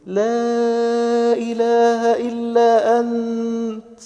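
high, very long held vowels; fervent address to God. Shown as a demonstration. Preacher showing what you say to Him, high and drawn-out. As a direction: stretch each word high, like a slow call to heaven. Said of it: "فأنت بتقول له".

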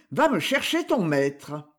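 plain reading voice

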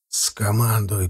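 low, subdued plea through clenched teeth. A narrator's reading. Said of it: "протянул я сквозь сжатые зубы".

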